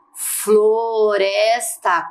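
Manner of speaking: "Floresta" stretched into flo-res-ta; syllable by syllable. Shown as a demonstration. Teacher asks pupils to count syllables, "Floresta" drawn out slowly. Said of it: "Quantas sílabas tem?".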